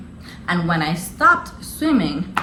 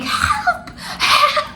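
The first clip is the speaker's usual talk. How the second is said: retold scream for help. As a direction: squeal it out like a mock scream. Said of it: "I'm there screaming".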